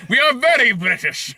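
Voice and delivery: lightly put-on British accent; playful British accent, half laughing; mock British accent. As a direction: toss off a cheeky British accent. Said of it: "that's how I imagine they spoke".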